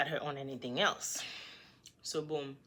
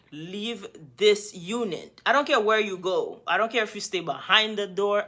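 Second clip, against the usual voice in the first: louder, firm demand to leave; firm order; 5 semitones higher, 6 dB louder than usual. Retold or imitated. Woman ordering the boyfriend out of her unit, firm and louder. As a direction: order him out, hard and steady.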